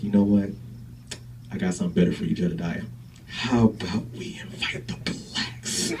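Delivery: low, sly, hushed suggestion, slowing at the end; sly Klan boss. Acted. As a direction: lean in and suggest it like a secret. Boss voicing conspiratorial suggestion.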